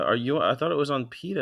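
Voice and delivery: usual speaking voice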